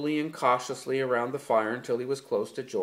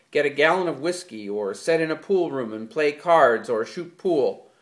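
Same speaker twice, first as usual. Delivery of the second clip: loud, high furious tirade; angry rant; 4 semitones higher, 6 dB louder than usual. A narrator's reading. George to Lenny about freedom alone, furious and loud. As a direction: rant like a fed-up man venting everything.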